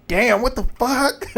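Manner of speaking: high, slow, drawn-out what the fuck; stunned disbelief. Retold or imitated. Telling viewers how she reacted, high stunned disbelief. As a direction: let it hang, eyebrows up, disbelieving.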